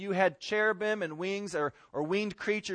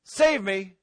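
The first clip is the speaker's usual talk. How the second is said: forceful, raised-voice demand; 2 semitones higher, 7 dB louder than usual. Shown as a demonstration. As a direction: bark it as an order. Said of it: "That's a lot different".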